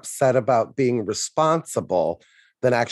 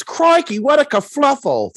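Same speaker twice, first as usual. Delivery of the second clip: high, loud hammed-up Australian accent; loud mocking Australian accent; 13 semitones higher, 6 dB louder than usual. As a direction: overplay an Australian girl. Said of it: "You're Australian".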